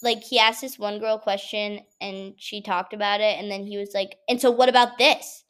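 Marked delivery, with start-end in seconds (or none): 4.3-5.4 louder, imitating a pushy professor; mock-aggressive challenge, punched out loud; aggressive questioning. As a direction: fire the question like a confrontational teacher. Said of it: "he said it in a very aggressive way".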